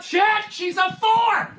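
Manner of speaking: very high, slow squeak turning to a shout; mock squeaky dismay. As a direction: shriek in fake dismay at the number.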